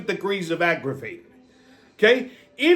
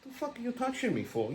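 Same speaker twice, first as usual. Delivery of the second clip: muttered retort; 13 dB quieter than usual. Retold or imitated. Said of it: "I'm like".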